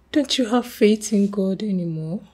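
ordinary talk, nothing marked

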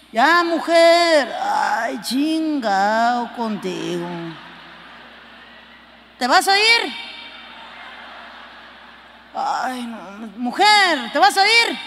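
arrastrando lento, luego sube fuerte la pregunta; marido desganado. Retold or imitated. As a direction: flojo desde el sillón, casi sin moverse. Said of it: "nomás te dice". (el marido en el sillón, fastidiado y gritón)

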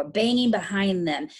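ordinary delivery

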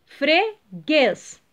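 "Freguês" split in two, weight on "guês"; stress on last syllable. Shown as a demonstration. Teacher giving pupils an example, stressing final "guês" in "Freguês". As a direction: break "Freguês" apart and punch "guês". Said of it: "O guês é a última sílaba tônica".

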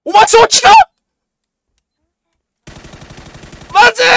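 high, loud panicked yelp into laughter. Real, not acted. Spotting an enemy behind him, a loud panicked yell. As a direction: blurt it out in a panic.

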